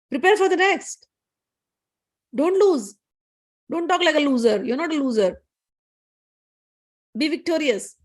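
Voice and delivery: mildly acted, high-pitched encouragement; acted motherly urging. Mother urging defeated son in story.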